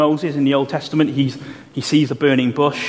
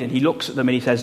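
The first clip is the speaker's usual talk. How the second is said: ordinary talk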